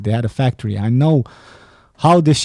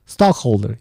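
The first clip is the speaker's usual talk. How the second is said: usual speaking voice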